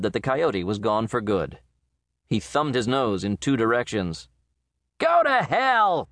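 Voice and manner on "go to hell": slow, drawn-out curse, much higher and louder; shouted. A narrator's reading. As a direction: fling the curse across the snow at the coyote. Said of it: "he shouted".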